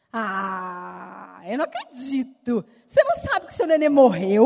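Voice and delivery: rising to a loud incredulous question; stunned the mother doesn't know; shocked disbelief. Retold or imitated. Nurse telling the mother her baby died, rising to loud disbelief.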